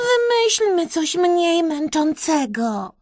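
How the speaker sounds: high-pitched tired complaint; Kreska, moaning. A narrator's reading. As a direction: plead in a high, whiny voice. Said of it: "jęknęła w pewnej chwili Kreska".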